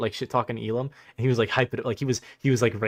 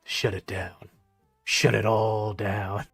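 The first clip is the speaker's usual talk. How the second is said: low, soft, slow dramatic command; mock grim order; 4 semitones lower, 4 dB quieter, much slower than usual. Rival checking his YouTube studio, mock grim low order. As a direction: deliver it like a doomed general. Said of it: "he's like".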